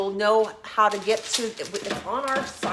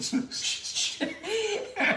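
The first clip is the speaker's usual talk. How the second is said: high giggling laugh; 11 semitones higher than usual. Real, not acted. Laughing at own stumble.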